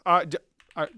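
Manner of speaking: usual speaking voice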